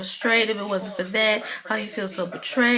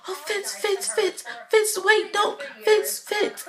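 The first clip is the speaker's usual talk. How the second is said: very high, repeated anxious calls; mock frantic pleading; 12 semitones higher, slower than usual. Olivia chasing Fitz as he runs, frantic pleading. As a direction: imitate someone desperately trying to stop him.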